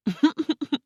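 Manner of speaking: high staccato character laugh; mocking laugh. A narrator's reading. Guardian laughs at Dorothy's question, high staccato laugh. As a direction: laugh in a quick string of ha-has. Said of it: "The guardian of the gate laughed".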